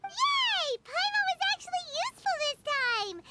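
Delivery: high, delighted squeal that settles; excited cheer. Acted. Paimon cheers at being useful for once, high and delighted. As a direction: bounce with pride at being useful.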